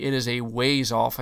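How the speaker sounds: ordinary talk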